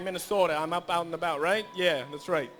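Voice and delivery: a light Minnesota accent, mocking a friend. MC imitating Yeti's Minnesota accent for the crowd, light Minnesota accent. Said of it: "he has an accent like this".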